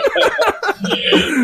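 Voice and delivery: short high laughter. Real, not acted. Laugh after joke.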